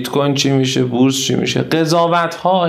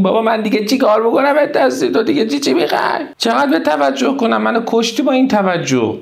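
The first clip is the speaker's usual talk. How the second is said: higher-pitched, fed-up complaint; acted exasperation; 7 semitones higher than usual. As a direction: play someone whose nerves are frayed. (کلافه و عصبی، با صدای زیرتر)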